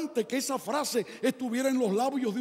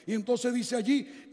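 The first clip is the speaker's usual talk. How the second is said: usual speaking voice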